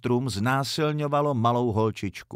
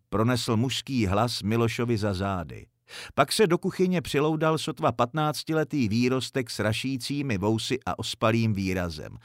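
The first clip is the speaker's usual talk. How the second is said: usual speaking voice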